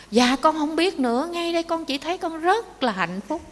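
high-pitched, happily bewildered answer; elated reply. Acted. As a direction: act out a man giddy with happiness. Phú hộ đáp, đang ngập hạnh phúc. (đáp giọng cao, sung sướng)

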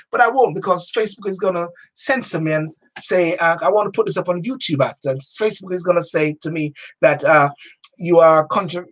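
usual speaking voice

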